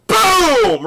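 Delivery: very high, loud boom; stretched-out explosive mock gunshot; gunshot sound effect. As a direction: shout a sudden gun blast. Imitating a gunshot.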